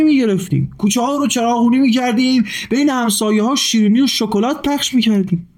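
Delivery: put-on high, lively child telling a story; a boy proudly retelling his grandpa's tale; eager high child's storytelling voice; excited little boy. A narrator's reading.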